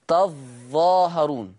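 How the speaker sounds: تظاهرون slow, with a doubled, stressed ظ; Warsh reading. Shown as a demonstration. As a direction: draw out تظاهرون with the ظ held double. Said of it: "يقرأ ورش بتشديد الظاء".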